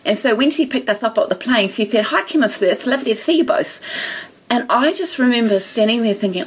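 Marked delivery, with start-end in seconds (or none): 2.1-3.8 higher-pitched greeting with mimicked lisp; imitated speech impediment. Lady greeting two visitors off the plane, lisping. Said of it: "she's got a speech impediment".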